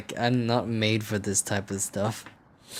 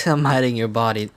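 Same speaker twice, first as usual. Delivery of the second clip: laughing; 3 semitones higher, 7 dB louder than usual. Real, not acted. Laughing at own joke.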